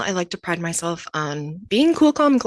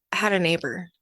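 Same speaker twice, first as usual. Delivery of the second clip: ordinary talk, nothing marked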